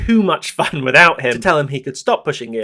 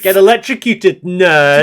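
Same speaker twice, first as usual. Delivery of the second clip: taunting; 5 semitones higher, 7 dB louder than usual. Pretend. Mocking the dead scientist.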